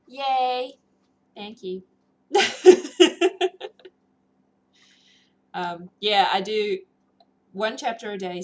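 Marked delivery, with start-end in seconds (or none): 2.3-3.9 high, loud laugh; a happy burst of laughter. Real, not acted. Streamer thanked by chat, laughing.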